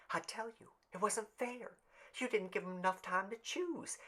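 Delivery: high, soft, urgent woman's voice; Tessie's hushed protest. Acted. Tessie protesting to the crowd, high and hushed.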